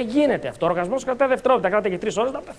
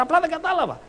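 higher-pitched mock exasperation; exasperated pupil; 7 semitones higher, 3 dB quieter than usual. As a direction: plead like a pupil still lost on the third try. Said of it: "Η δασκάλα το λέει τρίτη φορά".

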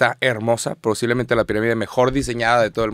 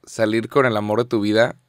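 ordinary talk, nothing marked

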